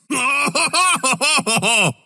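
high gloating laugh; victory chuckle; gleeful laugh. Acted. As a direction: laugh in triumph. Laughing after a kill.

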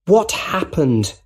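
high, slow, mild shout. A narrator's reading. Nephew at the hospital bedside, shouting for answers. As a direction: call it out in acted alarm. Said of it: "shouted Peter".

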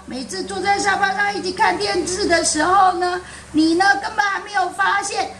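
put-on little boy complaining, pitched high; high childish voice. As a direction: kid tattling on dad. Boy telling dad off, voiced.

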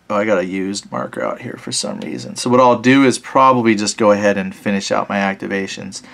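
normal talking voice

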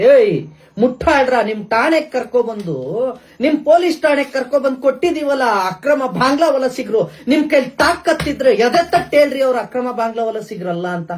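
loud, high, furious shouting at the police; real outraged yelling; angry shout. Real, not acted. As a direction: yell your challenge at them.

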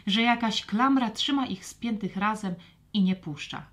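plain reading voice

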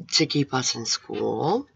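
ordinary delivery

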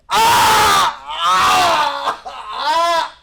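high drawn-out cry of pain; acted scream. Stabbed woman crying out, high acted screams. Acts out being stabbed in back.

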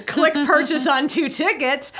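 high, loud, drawn-out glee; playfully acted-out instant purchase; gleeful eagerness. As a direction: pounce on the offer, triumphant. Acting out her instant eager purchase.

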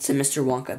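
ordinary delivery, nothing marked

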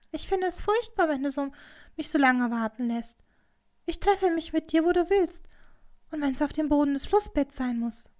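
high-pitched, near-whining entreaty; pleading. A narrator's reading. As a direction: beg a lover desperately. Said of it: "mit flehentlichem, fast winselndem Ton".

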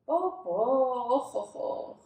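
sighing tut-tut over the mess; a father's weary tut-tut; mild dismay. Acted. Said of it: "λέει μόλις βλέπει τα νερά". (ήπια απογοήτευση)

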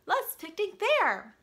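exclaiming. Acted. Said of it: "exclaimed Pooh".

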